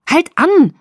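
high, full-out yell. A narrator's reading. Bella to Jacob as he races down the street, screamed. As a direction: scream it at the driver, high and loud. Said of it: "schrie ich wieder, lauter diesmal".

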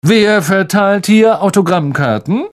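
a touch of the showy star; pompous teacher. Acted. Lockhart asks the crowd, lightly pompous. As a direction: ask it with theatrical flair.

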